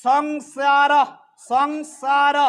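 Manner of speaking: সংসার stretched as song-saa-r, crisp s; Sanskrit pronunciation demo. Shown as a demonstration. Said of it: "চয়ের মতো উচ্চারণ করব".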